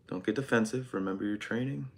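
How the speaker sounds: soft muttered note to self; calm yourself down under your breath; quiet self-talk. Real, not acted. Calms himself after outburst.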